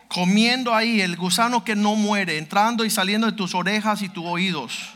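ordinary talk

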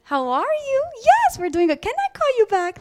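high, put-on polite phone greeting; sweet phone voice. Mother answering the phone mid-tantrum in a sweet voice. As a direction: switch to an overly sweet caller voice.

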